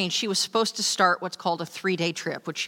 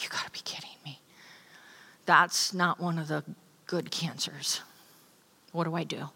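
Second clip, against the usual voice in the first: stunned whisper; 6 dB quieter than usual. Retold or imitated. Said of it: "I'm like".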